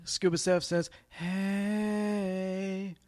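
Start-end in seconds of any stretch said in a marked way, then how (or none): 1.1-3.0 airy, breathy little greeting; breathy hey. Retold or imitated. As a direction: breathe the word out softly. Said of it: "'Cause that was breathy".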